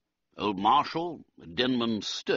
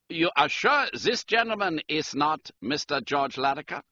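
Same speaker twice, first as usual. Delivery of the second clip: higher-pitched German count; German-accented aristocrat, pitched up; 7 semitones higher than usual. A narrator's reading. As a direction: address him formally in a German accent. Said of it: "He spoke with a German accent".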